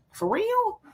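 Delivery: surprised. Real, not acted. Reacting to a bomb comment.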